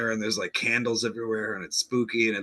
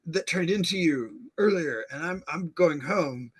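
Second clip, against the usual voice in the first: higher, rambling panicked account; flustered mock Hannah; 6 semitones higher than usual. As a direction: babble it out in a high voice.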